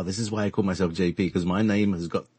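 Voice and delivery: ordinary talk, nothing marked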